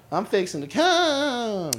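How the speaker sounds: high, slow Southern drawl; Southern accent. Retold or imitated. Repeating the punchline to cohosts in a Southern drawl. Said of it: "that's why I love the Southern accents".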